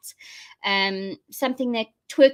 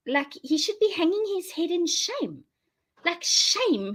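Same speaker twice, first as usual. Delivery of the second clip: mocking shame; 8 semitones higher than usual. Mocking the minister's exit.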